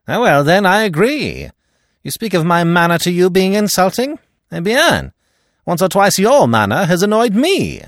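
high, loud, indignant retort; heated quarrel. Acted. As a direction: fire back loudly at a rival, pitched right up. Giraud and Poirot quarrel over insults.

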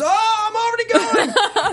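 very high, drawn-out yell; hammed-up cry of someone dropping away; mimicked falling scream. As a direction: wail it high, slow and loud. Acts out partner falling from plane.

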